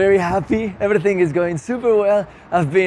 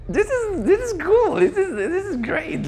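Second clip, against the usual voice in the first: excited; 11 semitones higher than usual. Real, not acted. First time recognized, thrilled.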